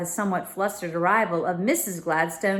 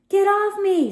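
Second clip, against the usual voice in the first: high, loud panicked squeal; 13 semitones higher, 6 dB louder than usual. A narrator's reading. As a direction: shriek it as if grabbed from behind. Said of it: "squealed Stella".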